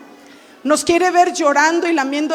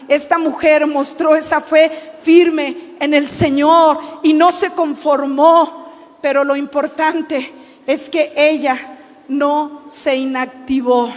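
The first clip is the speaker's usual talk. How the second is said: slow weighty point; slower than usual. Acted.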